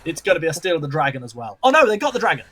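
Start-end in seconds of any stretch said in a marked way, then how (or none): 1.6-2.4 high, loud shocked outburst; excited shout. Real, not acted. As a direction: yell it as the steal happens. Caster reacts to dragon steal.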